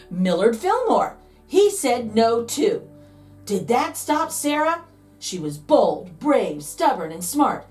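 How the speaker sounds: usual speaking voice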